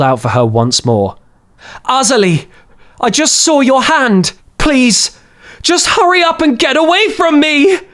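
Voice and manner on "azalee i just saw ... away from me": high pleading scream building up; voiced pair, boy then woman screaming. A narrator's reading. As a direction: cry out, pleading then ordering. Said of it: "she screamed again".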